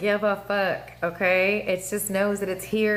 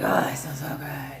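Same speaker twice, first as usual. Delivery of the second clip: mock blissful sigh; 5 semitones lower, 3 dB quieter than usual. Voicing blissful afterglow.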